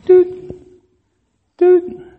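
very high squeaky mock cry; mock baby sound. Baby starting to breathe again, very high mock sound. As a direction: imitate the baby coming back, very high.

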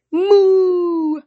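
high, loud joyful moo; happy moo. Acted. Freed calf mooing happily at its rescuer. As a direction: moo with delight.

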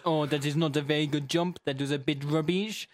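hammed-up French accent; comic French-accented potato. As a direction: judge it like a comic French compère. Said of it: "and a French accent".

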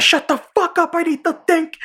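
high yell, losing his mind; mock frantic outburst. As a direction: imitate someone cracking under pressure, very high. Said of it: "losing his mind".

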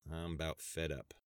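understated, fed-up weariness; weary sigh. A narrator's reading. Sheriff after reading the headline, wearily. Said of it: "Sheriff Woods sighed heavily".

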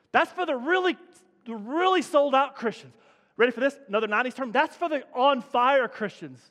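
lampoon the churchy label with big fake zeal; mock enthusiasm. Preacher mocks what lukewarm people call zealous believers, loud and hammed up.

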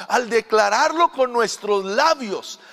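normal talking voice

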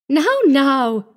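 put-on jolly the child along with forced lightness; forced cheer. A narrator's reading. New mother covering her unease to the child, forced cheer. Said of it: "laughed with an effort".

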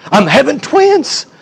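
high-pitched, drawn-out acted excitement; slow, high, thrilled reveal; excited announcement. Woman announces twins to her husband.

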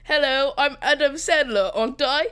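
loud, hammy British Adam Sandler impression; mock British accent. Mock British accent for the Adam Sandler reenactment. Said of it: "just do a British accent".

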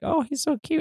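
high cooing over a cute bird; lightly voiced spa lady gushing over the bird. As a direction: coo like a doting spa lady.

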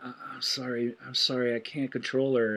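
soft, sheepish, hangdog apology; meek apology. Retold or imitated. Fredo apologizing to Michael about his wife, quiet and sheepish. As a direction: shrink into a quiet apology to your brother.

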